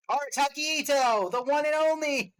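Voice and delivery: loud, high announcer shout with long vowels; hype announcer. Acted. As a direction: belt out the name like a ring announcer. Announcing the character's name with fanfare.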